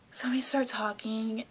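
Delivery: ordinary delivery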